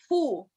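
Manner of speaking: "Phu" as poo with a puffed P; first beat "Phu", P not F; syllable demonstration. Shown as a demonstration. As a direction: sound out "Phu" alone with a long oo. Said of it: "It's almost like this".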